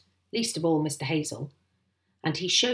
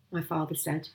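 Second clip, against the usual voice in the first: usual speaking voice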